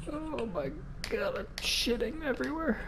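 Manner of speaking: terrified high whimper. Real, not acted. Terrified by game.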